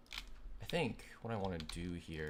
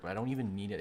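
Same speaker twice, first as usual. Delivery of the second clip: ordinary talk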